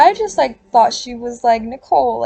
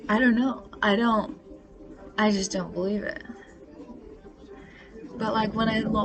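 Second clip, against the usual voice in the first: ordinary delivery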